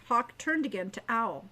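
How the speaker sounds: plain reading voice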